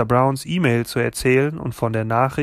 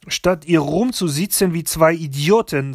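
put-on higher, scolding French-accented voice; blunt French grandmother; 6 semitones higher, 4 dB louder than usual. A narrator's reading. (resolute französische Großmutter)

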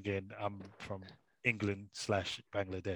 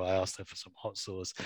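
normal talking voice

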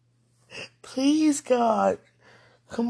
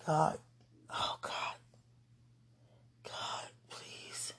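much softer, whispered through tears; crying whisper; 11 dB quieter, much slower than usual. Real, not acted. Begging God for help, crying in a whisper. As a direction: sob the plea in a whisper.

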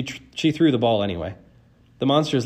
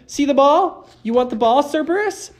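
loud, high dog-training call; shouting; 7 dB louder than usual. A narrator's reading. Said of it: "She shouted".

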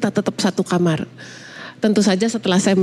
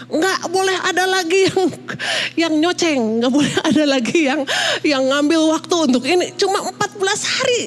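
high-pitched retold protest; indignant insistence; 7 semitones higher than usual. Mother refusing husband any intrusion on family time, indignant insistence. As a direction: lay down the rule, voice pitched right up. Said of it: "saya bilang gitu".

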